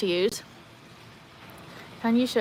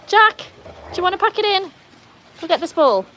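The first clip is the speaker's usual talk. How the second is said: high, loud scold; scolding the dog; 9 semitones higher, 7 dB louder than usual. Real, not acted. Owner telling off her bullying dog loudly. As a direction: tell Jack off sharply.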